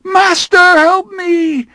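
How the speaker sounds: very high, loud cry for help; terrified scream. Acted. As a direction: scream for the master. Armand flung into the flames.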